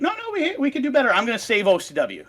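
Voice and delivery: high, pleading mock protest; mock would-be savior. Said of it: "And he's like".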